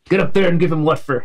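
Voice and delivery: urging a comrade on, in character; in-character rallying line. Acted. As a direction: spur your ally on, in character. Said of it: "clap a hand on Helldust's shoulder and say".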